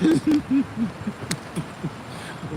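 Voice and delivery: high, breaking-off chuckle; laugh. Real, not acted. Amused at how hype [name] gets, laughing. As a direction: let a real laugh trail on.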